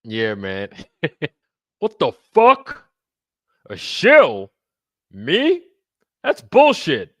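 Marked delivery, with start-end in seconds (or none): 1.8-7.1 much higher, louder indignant voice; impersonation. Retold or imitated. Host doing an impression for the chat, outraged and high. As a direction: play the outraged guest. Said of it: "come for the impersonations".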